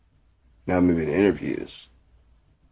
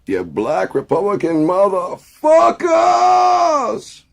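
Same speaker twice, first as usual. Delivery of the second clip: very high, slow, louder screaming insult; cracking shout; 9 dB louder, much slower than usual. Pretend. Mock rally rant.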